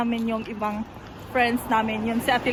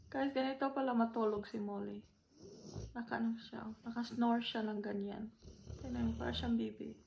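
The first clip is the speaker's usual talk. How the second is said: much softer, hushed voice; 13 dB quieter than usual. Real, not acted. Vlogger beside sleeping Molly, hushed quiet talk. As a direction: keep it down so she sleeps.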